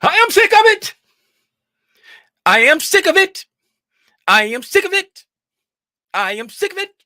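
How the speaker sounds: high, loud exasperated cry; fed up shouting. Real, not acted. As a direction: yell it out like you've had enough. Venting, repeated loud cries.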